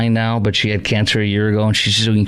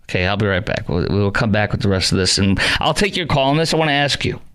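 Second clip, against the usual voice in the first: normal talking voice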